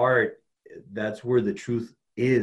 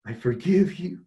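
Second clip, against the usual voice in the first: higher, emotional retelling; imitated choked-up voice; 7 semitones higher than usual. Coach retells client forgiving himself, imitating choked-up voice. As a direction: imitate someone overcome while forgiving. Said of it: "I could hear him trembling".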